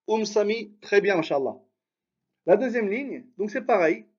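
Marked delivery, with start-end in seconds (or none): none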